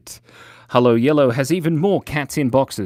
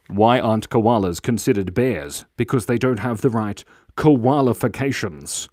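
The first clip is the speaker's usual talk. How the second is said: ordinary talk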